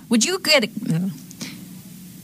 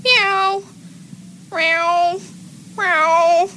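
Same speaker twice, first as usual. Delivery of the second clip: lightly pitched-up meows; vary the meow; cat meows; 8 semitones higher, much slower than usual. Acted. Different types of meowing, a little higher. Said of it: "different types of meowing".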